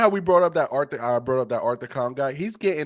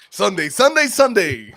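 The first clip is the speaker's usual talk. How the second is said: hype announcer; 6 semitones higher, 7 dB louder than usual. Pretend. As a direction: sell it like a monster truck ad. Hyping Chris Evans like promo.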